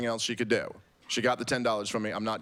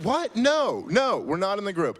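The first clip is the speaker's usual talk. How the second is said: much higher, incredulous what, then firm no; indignant denial; 9 semitones higher, 4 dB louder than usual. Retold or imitated. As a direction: sputter the denial in shock. Outraged reply to the manager.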